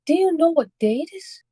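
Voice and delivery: put-on old woman's question pitched high; her asking. Shown as a demonstration. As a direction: ask it in a high, pleading old lady voice. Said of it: "or this is her asking".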